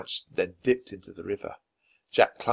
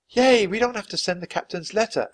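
put-on high, loud cheer from the girl; excited high-pitched outburst; exclaiming; 11 semitones higher, 6 dB louder than usual. A narrator's reading. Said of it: "Annie exclaimed".

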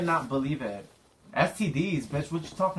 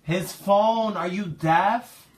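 exasperated; 7 semitones higher, 5 dB louder, much slower than usual. Acted. Prank caller scolding the victim.

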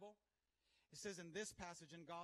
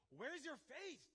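high, pointed question; challenging question; 7 semitones higher, slower than usual. Acted. Jesus confronting his disciples, challenging question. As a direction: put it to them as a challenge. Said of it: "Jesus is challenging his disciples".

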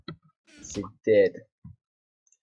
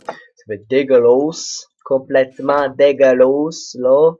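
slow, loud mock Québécois drawl on dégueulasse; a jokey Québécois accent that isn't tip top; 9 dB louder, slower than usual. Shown as a demonstration. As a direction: stretch it out in a hammed-up Québécois accent. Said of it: "Mon accent canadien n'est, n'est pas, enfin québécois".

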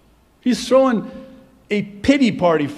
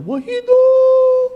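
high, drawn-out mock yell; mom's yell; 9 dB louder, much slower than usual. Mom mad at her growing child, yelling loud and high. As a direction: imitate a mother's piercing, sustained holler. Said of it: "she would yell".

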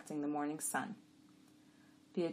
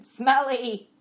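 higher, loud donkey insistence; insistent; 8 semitones higher, 14 dB louder than usual. A narrator's reading. Jack bickering with his partner, loudly insistent. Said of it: "Costel insisted".

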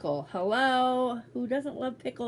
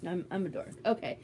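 ordinary talk

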